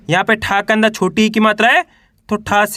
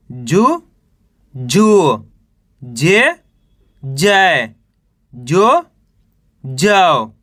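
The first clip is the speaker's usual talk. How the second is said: lower, each syllable set apart by pauses; syllable drill; 5 semitones lower, much slower than usual. Shown as a demonstration. Teacher reciting the ga row to students, lower and deliberate.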